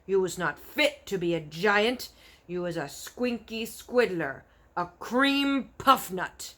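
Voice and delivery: loud, mocking giant shout; scornful giant insults. Bloodbottler mocking the BFG before leaving. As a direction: sneer the names at him.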